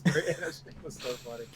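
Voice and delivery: ordinary talk, nothing marked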